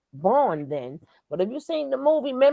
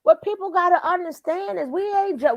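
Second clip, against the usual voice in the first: ordinary talk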